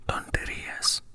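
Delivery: ordinary delivery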